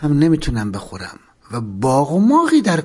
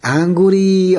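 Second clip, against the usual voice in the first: vendor's call; 3 semitones higher, slower than usual. Retold or imitated.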